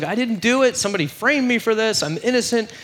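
high-pitched urgent protest; acted-out desperate denial; swearing innocence. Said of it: "he's, like, swearing to him".

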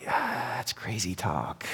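very soft, slow, low sigh of disbelief; dismissive aside. Acted. Crowd member doubting what they heard, hushed dismissal. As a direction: breathe it out under your voice, slowly. Imagined listener dismisses the claim.

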